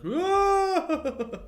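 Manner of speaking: high, drawn-out "whoo" wail; mock shriek. Reacting to the witch's new art form, mock shriek. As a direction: let out a long, high mock cry.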